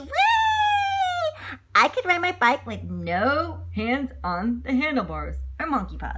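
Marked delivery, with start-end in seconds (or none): 0.0-1.6 high, loud whee; gleeful whee. Acted. George showing off a bike trick, squealing whee. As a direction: squeal with fun. Riding with no hands.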